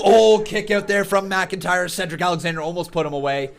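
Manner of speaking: screaming ringside play-by-play, high; wrestling commentator. Real, not acted. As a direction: call the near falls at full hype. Live play-by-play of match.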